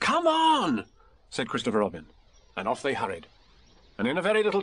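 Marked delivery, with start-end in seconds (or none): 0.0-0.9 put-on high, loud boyish hurry-up; eager call to get moving; urging. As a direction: cut in and chivvy them along. Boy cuts in, hurrying off.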